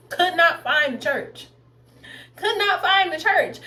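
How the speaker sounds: high, slow, word-by-word exasperation; exasperated emphasis. Real, not acted. Storyteller stressing her baffling church search, exasperated emphasis. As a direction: punch each word slowly in disbelief.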